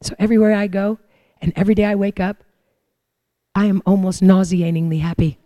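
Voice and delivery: normal talking voice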